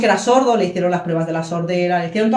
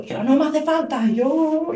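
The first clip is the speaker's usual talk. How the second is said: retold tearful refusal; 6 semitones higher, slower than usual. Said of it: "Y yo".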